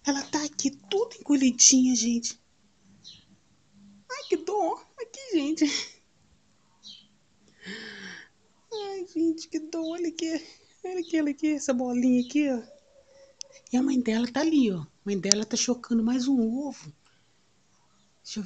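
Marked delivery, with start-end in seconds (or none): 4.1-12.6 pitying coo. Real, not acted. Cooing with pity to viewers over the tiny fallen bird.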